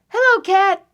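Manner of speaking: high, loud duck voice; cheery duck greeting. Acted. Duck greets cat while riding past, high duck call.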